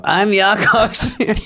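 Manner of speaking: laughing Yakov Smirnoff impression; a laughing Russian accent, drawn out. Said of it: "I did open and go".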